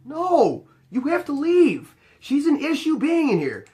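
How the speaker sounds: high-pitched, exasperated insistence; insistent refusal. Retold or imitated. Doorman telling the couple to leave, exasperated and insistent.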